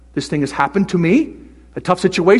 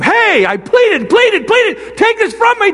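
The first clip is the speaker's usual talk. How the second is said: much higher, loud shouted begging; pleading shout; 6 dB louder, slower than usual. Retold or imitated. Paul before, shouting his plea to God. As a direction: cry out to have it removed. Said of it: "it changes it from".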